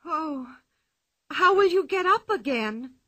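frightened for her friend; anxious cry. A narrator's reading. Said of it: "cried Anika".

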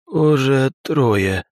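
emotionless, very slow answer; deadpan meme voice. A narrator's reading. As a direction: drop every feeling, drag it out. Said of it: "безэмоционально ответил он". (безэмоциональный голос)

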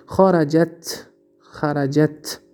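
slow, syllable by syllable خرجت with the final ت held still; slow model pronunciation for a class; slow teaching demo. Shown as a demonstration. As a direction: spell out خرجت slowly so students hear the ت. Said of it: "دیدین؟".

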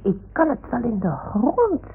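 worked-up, indignant cry; acted anger. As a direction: rage like a stamping child. Acted anger at the little house.

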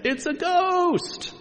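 high, drawn-out mock scream; exaggerated frightened shriek, stretched out; terrified cry. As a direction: wail it high in hammed-up terror. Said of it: "they were terrified and said".

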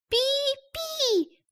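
vague bird chirp. Acted. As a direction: chirp without committing. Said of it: "неопределённо ответила она".

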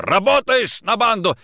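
high, loud shouted question; furious interrogation. Acted. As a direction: demand an answer in a rage. Interrogator strangles suspect.

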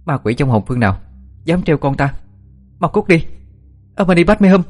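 mother shouting at a ghost to get out; fierce scolding shout. Acted. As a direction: drive the ghost away with a furious cry. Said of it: "Cô quát lớn".

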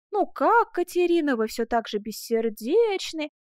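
mocking imitation of a mournful suitor; Паголо. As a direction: mimic his familiar refrain, stretching the words. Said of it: "Только он заведёт свою песенку".

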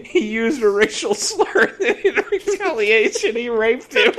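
high-pitched, struggling through laughter; mock witness, laughing. Stirring secretary telling what she saw, laughing. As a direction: play the tattling witness while cracking up.

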